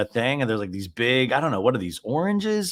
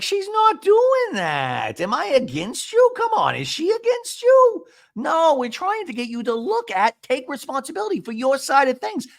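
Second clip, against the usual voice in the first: mocking Gina impression. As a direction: squeak her patient scolding way up high. Gina quoted mid-argument.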